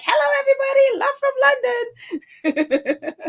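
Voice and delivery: high, sing-song, slowed-down mock greeting with a laugh; mock cheery greeting. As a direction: play up the viewer's cheery hello, drawing out love from London. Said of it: "Or it's like".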